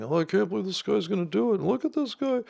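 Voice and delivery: high, excited ad-guy impression; mock commercial guys. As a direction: ham up two gawking onlookers. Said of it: "they're saying".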